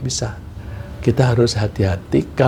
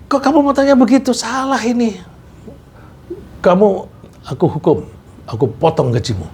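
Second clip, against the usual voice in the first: angry scolding boss; 9 semitones higher than usual. Pretend. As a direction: scold him for the bad cut, voice climbing high.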